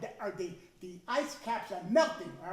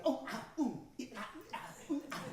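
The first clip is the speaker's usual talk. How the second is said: put-on comic ouch sounds as the kid gets hit; mock yelps of pain; 3 semitones higher, 4 dB quieter than usual. Kid yelping under his mother's spoon, mock pained cries. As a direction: yelp like a kid being smacked on the head. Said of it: "singing a different tune".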